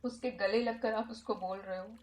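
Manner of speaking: hushed therapist leading a healing exercise; guided meditation. Acted. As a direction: guide the listener gently through the visualization.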